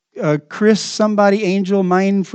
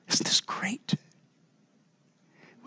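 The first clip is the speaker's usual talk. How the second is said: hushed aside to the congregation; whisper; 14 dB quieter, much slower than usual. Real, not acted. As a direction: lean in and whisper it. Preacher drops to a whisper.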